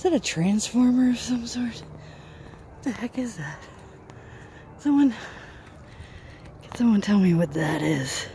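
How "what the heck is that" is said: hushed whisper at a strange object; breathy puzzled whisper. Real, not acted. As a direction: whisper so nobody hears. Puzzling over odd structure.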